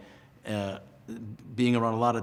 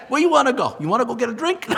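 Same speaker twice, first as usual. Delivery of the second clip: high, loud, laughing street greeting; loud natural self; 14 semitones higher, 12 dB louder than usual. Retold or imitated. To the teacher, loud laughing casual greeting. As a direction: blurt it out big and unpolished, laughing. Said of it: "so I go".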